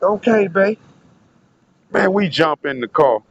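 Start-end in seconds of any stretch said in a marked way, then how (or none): 0.0-0.8 lightly voiced sweet girl, a bit higher; a hint of a sweet, spacey girl; sweet girlfriend. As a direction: mimic her sweet, dizzy agreement. Girl agreeing sweetly in story.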